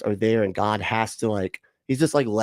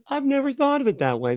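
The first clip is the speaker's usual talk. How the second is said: much higher, gushing mock amazement; mock awe. Mocking the apologist's amazed guest, high mock awe. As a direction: parrot the line like an SNL bit. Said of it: "almost like a fucking Saturday Night Live bit".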